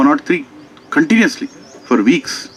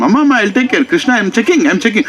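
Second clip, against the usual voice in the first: lightly acted, raised-pitch reassurance; placating doctor; 7 semitones higher than usual. Doctor to insistent mother while checking Krishna, higher mock placating. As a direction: imitate a harried doctor soothing someone.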